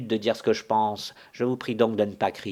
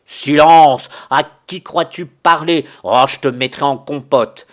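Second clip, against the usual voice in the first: very loud, higher furious bellow; roaring anger; 5 semitones higher, 14 dB louder than usual. Acted. Visitor roars at the old servant to shut up. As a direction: blast the servant into silence.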